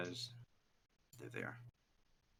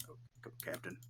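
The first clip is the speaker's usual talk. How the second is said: whispered urging; 2 semitones lower, 18 dB quieter, faster than usual. Acted.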